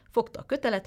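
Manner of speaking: ordinary delivery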